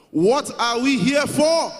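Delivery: high, drawn-out full shout; loud chant leader; rallying shout. Real, not acted. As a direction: holler slowly to rouse the whole hall. Urging standing crowd to shout.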